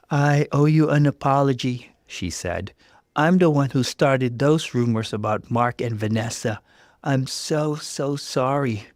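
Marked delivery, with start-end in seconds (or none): 0.1-1.9 nervous apology. Acted. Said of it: "she looked nervous". 3.2-8.9 remorseful coworker. Acted. Coworker confessing to Jess, remorseful.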